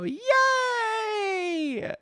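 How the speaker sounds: very high, drawn-out mock cheer; crowd cheering. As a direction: imitate a delighted crowd shrieking. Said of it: "And everybody go".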